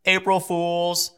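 slow, drawn-out kid voice, only lightly put on; a hint of a little boy. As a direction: lightly play the proud four-year-old. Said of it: "He says".

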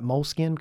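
"Moleskine" said simply as mole-skin; Tennessee pronunciation. Shown as a demonstration. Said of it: "'cause we're in Tennessee".

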